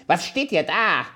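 high, loud angry little dwarf; shrieking dwarf. A narrator's reading. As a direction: screech at the girls from the trapped beard. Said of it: "und schrie".

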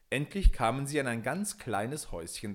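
usual speaking voice

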